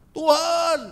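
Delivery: high loud cry to God; desperate shout. Acted. Believer screams at God instead of listening, high loud cry. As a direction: yell his name up at heaven.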